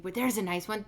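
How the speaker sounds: usual speaking voice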